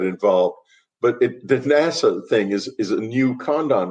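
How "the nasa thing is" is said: ordinary delivery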